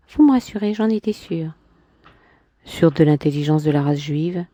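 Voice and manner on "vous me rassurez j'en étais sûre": higher, lightly voiced woman; a hint of a proud woman; Clémentine's voice. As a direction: lift into her haughty tone. Man voicing woman in dialogue.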